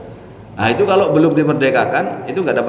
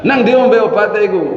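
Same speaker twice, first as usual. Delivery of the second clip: mock shout; 10 semitones higher, 6 dB louder than usual. Wife shouting about medicine.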